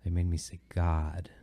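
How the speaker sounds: put-on small, soft child's confession; hushed, hesitant retold admission; very timid. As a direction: imitate a timid kid owning up quietly. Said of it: "very timidly, she goes".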